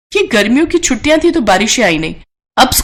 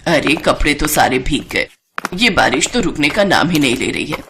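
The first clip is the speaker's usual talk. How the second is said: put-on a lower, older mother-in-law voice; 7 semitones lower, 4 dB quieter than usual. Mother-in-law offers her daughter-in-law help with laundry, lower older voice.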